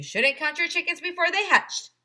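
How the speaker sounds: high, loud motherly scolding; mom voice. Acted. Mom in the car correcting A J, motherly voice. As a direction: lecture your kid gently. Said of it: "she replied".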